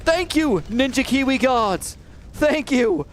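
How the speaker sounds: very high, thrilled cry; joyful relief. Real, not acted. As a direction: burst out happy the game is back. Game reconnects, relief.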